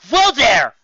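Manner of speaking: loud high call from above. Acted. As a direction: shout down to stop her. Pegasus calls out over chaos.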